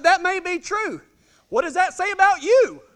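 high, loud comeback; triumphant retort. Retold or imitated. Husband answering his wife's jab, high loud retort. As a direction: throw it back with a lifted voice.

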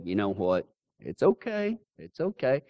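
put-on hushed, soothing girlfriend; soft calming. Girlfriend calming her angry boyfriend at the bar, soft and soothing. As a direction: softly talk him down from a fight.

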